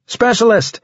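high, urgent call to a squadmate; shouted. A narrator's reading. Soldier calling the specialist in battle, shouting. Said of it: "I shouted".

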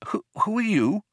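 high, stumbling question; startled stammer. A narrator's reading. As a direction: stammer it out, caught off guard. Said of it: "stammered Arthur".

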